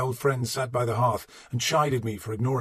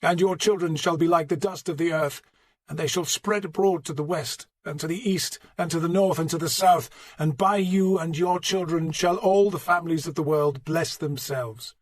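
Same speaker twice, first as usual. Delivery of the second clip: preaching; 6 semitones higher, 4 dB louder than usual. Acted. Archbishop preaching at funeral.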